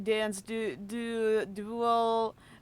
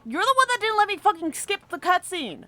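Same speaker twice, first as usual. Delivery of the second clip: higher and louder, blaming the game; angry rant; 6 semitones higher, 5 dB louder than usual. Real, not acted. Gamer ranting at the game about unskippable cutscenes, angry. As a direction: snap at the game accusingly.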